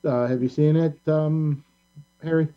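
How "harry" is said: ordinary delivery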